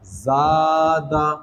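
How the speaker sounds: ज़ादा stretched very slowly, long aa; drawn-out ज़ादा. Shown as a demonstration. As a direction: pull out the vowels of ज़ादा. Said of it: "ये खिंचाएगा". (ज़ादा को बहुत खींचकर)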